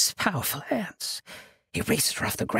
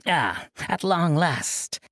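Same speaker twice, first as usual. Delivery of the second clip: laughing out the words; 7 semitones higher, 3 dB louder than usual. A narrator's reading. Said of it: "Ashmara laughed out the words".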